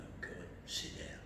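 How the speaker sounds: slow, very soft acted whisper; hushed whisper. Retelling being called into the boss's office, hushed whisper. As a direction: barely voice it, drawn out.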